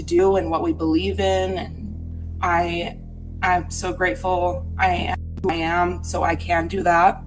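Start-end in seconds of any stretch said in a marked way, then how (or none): none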